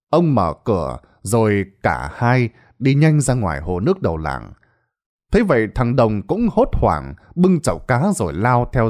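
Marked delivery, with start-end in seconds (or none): none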